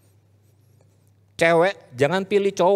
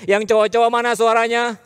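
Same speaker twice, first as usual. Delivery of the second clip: high, loud crowd-rousing shout; rousing call-out; 8 semitones higher, 5 dB louder than usual. Real, not acted. Preacher demands a louder response from the young men, rousing call-out. Rousing a too-quiet male audience.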